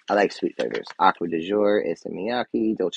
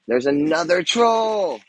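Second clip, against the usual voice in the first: higher and slower, thrilled discovery; excited; 9 semitones higher, 5 dB louder, slower than usual. Real, not acted. Spotting one more troll in the box, high thrilled cry. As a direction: gasp at the find.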